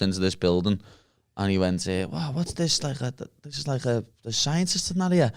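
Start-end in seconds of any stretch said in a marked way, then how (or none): 2.1-5.3 a higher, softer awed mimic of a mate; lightly voiced wide-eyed wonder; imitating Leon's awed voice. Imitating Leon walking into building.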